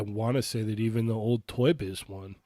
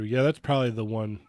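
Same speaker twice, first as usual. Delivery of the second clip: usual speaking voice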